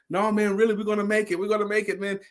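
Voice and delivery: high, upbeat reassurance to a scared crew; rallying pep talk. Retold or imitated. Columbus urges his nervous sailors to keep going, upbeat and high. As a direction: pump the men up, promising they'll make it.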